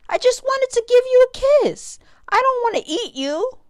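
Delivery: high, loud anxious plea; pleading. Acted. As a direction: desperately explain yourself. Pleading with fleeing stingray.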